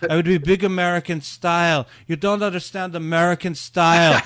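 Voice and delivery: put-on weird accent, growing emphatic; Tommy Wiseau impression. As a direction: mimic an oddly accented movie auteur, swelling into loud protest. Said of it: "suddenly you're talking like Tommy".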